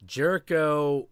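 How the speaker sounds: ordinary delivery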